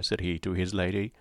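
ordinary talk, nothing marked

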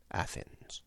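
near-whispered aside; barely audible, secretive reply; covert answer. A narrator's reading. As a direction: breathe it out almost without voice. Captive's covert answer to secret question.

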